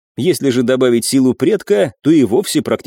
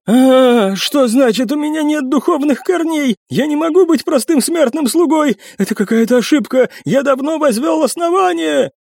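much higher, indignant disbelieving cry; panicked servant in nightmare; 13 semitones higher than usual. Acted. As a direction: protest in panicked denial.

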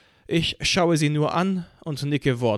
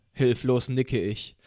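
ordinary delivery

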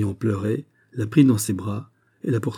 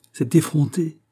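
a sharp, haughty jab, pitched higher; haughty retort; 7 semitones higher than usual. A narrator's reading. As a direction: throw it down with royal disdain. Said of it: "dit hautement la princesse".

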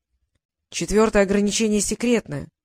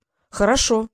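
ordinary delivery

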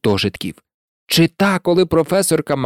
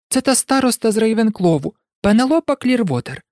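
Джінні; 6 semitones higher than usual. Acted. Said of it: "пояснила Джінні".

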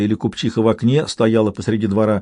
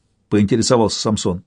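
ordinary delivery

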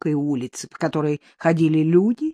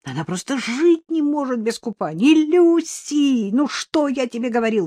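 high-pitched exasperated wail; distraught high-voiced fretting; upset lamenting; 7 semitones higher than usual. A narrator's reading. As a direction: bemoan the disobedient dog, pleading and scolding. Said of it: "расстроенно запричитал хозяин лабрадора".

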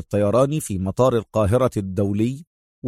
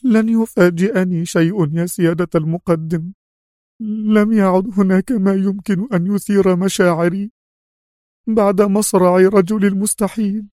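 sad resignation; 8 semitones higher than usual. A narrator's reading. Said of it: "هزت رأسها نفيا في حزن".

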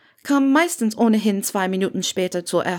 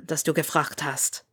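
softer, dry murmur; 4 semitones lower, 6 dB quieter than usual. A narrator's reading. Harry answers Hermine's repeated question, softer murmur. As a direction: mutter it half to yourself. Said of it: "murmelte Harry".

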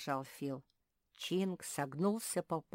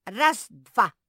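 loud counting; 6 semitones higher, 16 dB louder than usual. A narrator's reading. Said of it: "забубнил Чинк".

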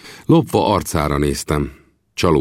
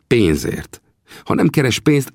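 usual speaking voice

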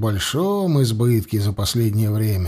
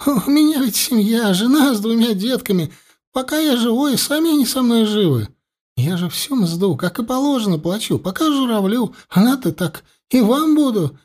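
высоко, торопливо, в страхе; мужичок частит, выпрашивая пощады; испуганная тараторка; 10 semitones higher than usual. A narrator's reading. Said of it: "всё тараторил испуганный мужичок". (испуганно тараторит)

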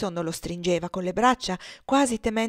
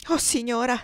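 distraught, higher-pitched appeal; a touch of desperation; beside herself; 5 semitones higher, 3 dB louder than usual. A narrator's reading. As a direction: appeal to her in mild frenzy. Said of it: "disse poi come fuori di sé".

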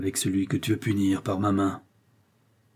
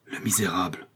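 whispered outcry; 3 semitones lower, 6 dB quieter than usual. A narrator's reading. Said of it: "s'écria Milady".

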